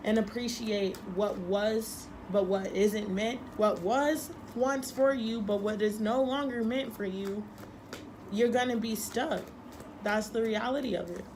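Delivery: ordinary talk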